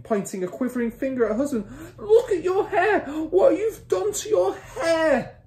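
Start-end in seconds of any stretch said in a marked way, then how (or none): 2.0-5.4 drawn-out, piercingly high shriek; mother's shriek. A narrator's reading. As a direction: wail it at full pitch, horrified. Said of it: "The mother was shrieking".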